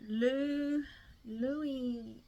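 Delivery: a clumsy try at a French accent on Louis; fumbling the French name Louis; attempted French accent. Shown as a demonstration. Vlogger sounds out the singer's French name, awkward French accent. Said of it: "I can't even do a French accent".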